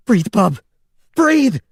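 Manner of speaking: high, loud desperate cry; shouting to keep him conscious. A narrator's reading. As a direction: yell to keep him awake. Doing CPR, desperate.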